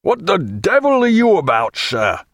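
high, indignant burst from an angry man; outraged cry. A narrator's reading. Canning from the open door, challenging, outraged. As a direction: cry out in outrage, then drop on SIR. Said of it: "cried Canning from the open door".

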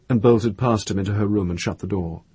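usual speaking voice

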